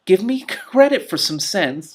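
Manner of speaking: high, slow, indignant cat; offended cat voice. A narrator's reading. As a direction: insist on some respect, drawn out. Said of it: "Trouble said".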